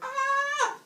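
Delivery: high, loud cry of someone snapping; sudden high yell as patience snaps; enraged scream. Retold or imitated. Teller as Heracles snapping at his teacher, enraged scream.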